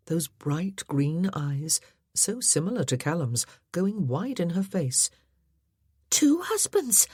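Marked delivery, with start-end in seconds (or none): 6.1-7.1 much higher, breathless astonished hush; shocked whisper. A narrator's reading. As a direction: gasp it out in disbelief. Shocked at two husbands.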